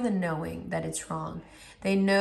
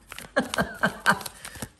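rueful laugh; 3 semitones higher than usual. Real, not acted. Laughing after admitting a lost temper, rueful chuckle. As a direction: run of quick voiceless chuckles.

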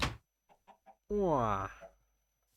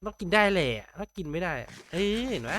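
excited; 6 semitones higher, 5 dB louder than usual. Real, not acted. Reacts to game result.

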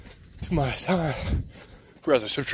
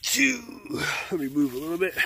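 ordinary delivery

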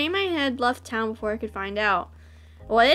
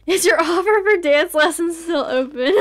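can't hold the laugh in; laughing while reading; laughing through it; 4 semitones higher, 5 dB louder than usual. Real, not acted. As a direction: read through giggles, louder.

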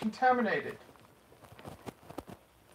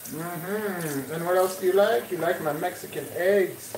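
put-on Mexican accent; 5 semitones higher, 11 dB louder than usual. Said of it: "Mexican accent".

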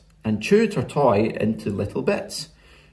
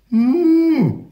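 long, high, loud moo; drawn-out animal moo; loud seal call; 6 dB louder than usual. A narrator's reading. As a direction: bellow like a mother seal. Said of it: "they heard a loud".

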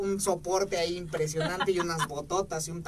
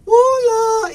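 put-on very high, loud girly "Hola"; mock falsetto hello; high sweet hello; 14 semitones higher, 13 dB louder than usual. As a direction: imitate her bright greeting, much higher. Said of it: "me hace".

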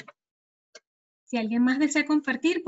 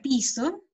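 "piso" stressed on the first syllable, PI-so; unaccented final O in "piso". Shown as a demonstration. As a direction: contrast "piso" by leaning on the I. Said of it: "En la I".

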